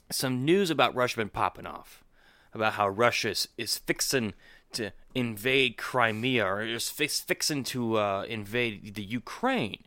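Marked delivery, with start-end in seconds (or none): none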